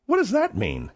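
ordinary talk, nothing marked

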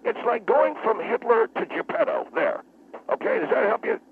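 high, sarcastic outburst; wound up. Acted. Guest correcting the host's winemaker remark, wound up. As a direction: sneer it back with pitch soaring. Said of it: "Will you calm down".

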